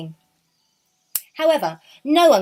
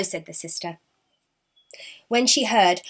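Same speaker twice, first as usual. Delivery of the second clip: ordinary delivery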